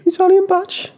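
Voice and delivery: put-on high, boyish Brummie voice; squeaky teenage Birmingham accent; Birmingham kid accent. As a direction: play the fourteen-year-old Brummie fan. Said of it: "Do an impression of how he, how he spoke to you".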